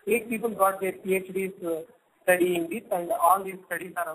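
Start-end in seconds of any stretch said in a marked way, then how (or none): none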